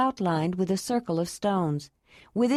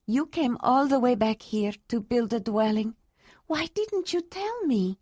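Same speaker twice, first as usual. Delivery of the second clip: higher, surprised woman's voice; 4 semitones higher than usual. A narrator's reading. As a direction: ask in happy surprise. Ayla surprised.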